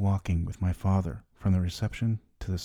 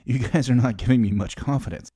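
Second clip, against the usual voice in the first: nervous joking; 4 semitones higher, 7 dB louder than usual. Retold or imitated. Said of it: "I nervously laughed".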